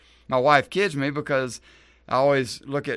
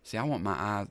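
ordinary delivery

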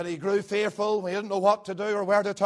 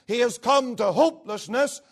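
ordinary delivery

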